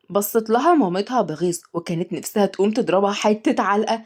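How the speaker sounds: plain reading voice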